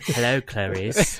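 slow, sinister Lecter greeting; drawn out like a polite cannibal; Hannibal Lecter voice. Retold or imitated. Cohost joins the bit with a slow Hannibal Lecter. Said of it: "that's your best Welsh accent yet".

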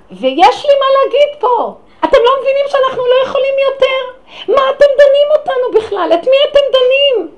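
laughing outraged shout; shouting at the court. Retold or imitated. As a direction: yell the complaint up at the judges. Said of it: "התחילה לצעוק".

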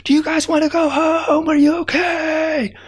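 high, calling shout to faraway ants; high shouted call across the snow. Acted. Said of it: "and I shout out".

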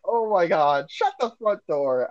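mild mock prude voice; mock prim exclamation. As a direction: play the polite swear, half mocking. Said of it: "And I'd be like".

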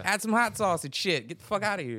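high, loud mocking dismissal; mock heckler taunt. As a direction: shoo the hater off with a sneer. Mock reply continues.

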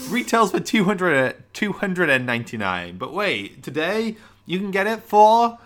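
hammed-up salesman building to the deal; infomercial pitch.

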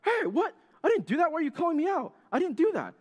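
very high, squawking mock protest; shrieky put-on outrage; complaining to referee. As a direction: argue a bad call in comic indignation. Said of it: "you start complaining to the ref".

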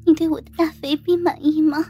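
high, moaning, breathless character voice; aroused flight attendant. Acted. Flight attendant to her passenger in the heat of intimacy, moaning. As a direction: play her worked up and pleading. Narrator acts the heroine's climax.

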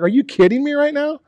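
high, indignant disbelief; incredulous whine. Pretend. Pastor imagining Paul bitten, high mock outrage. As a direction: complain to heaven, mock outrage. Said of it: "I'd just be like".

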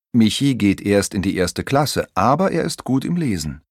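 usual speaking voice

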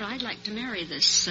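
ordinary talk

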